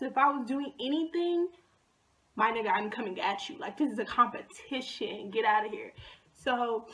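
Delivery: ordinary talk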